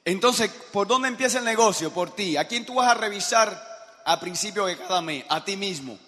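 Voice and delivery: loud, high announcing of each country to the room; booming roll call of countries; calling out to the crowd. Real, not acted.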